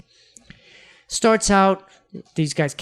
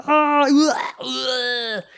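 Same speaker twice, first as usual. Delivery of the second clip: mock groan of disgust, pitched much higher; imitating a co-host's grossed-out "ugh"; 8 semitones higher, much slower than usual. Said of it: "you'll hear Andrew's response and be like".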